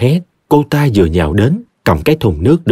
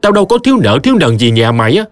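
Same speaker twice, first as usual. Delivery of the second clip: high-pitched, exasperated tirade; scolding; 7 semitones higher than usual. Acted. Tea seller tells off the girl who soaked her, scolding. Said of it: "bà háng giọng chửi".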